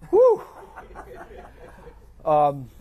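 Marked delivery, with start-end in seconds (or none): 0.1-0.4 loud, high-pitched whew; one loud exclaimed whew. Real, not acted. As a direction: let out a sharp, high whew. Reacting to positive self-talk idea.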